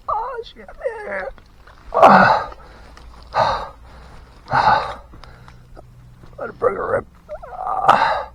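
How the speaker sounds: pained, breathless groans; wincing through rib pain; cracked rib. Real, not acted. Injured after crash, in pain.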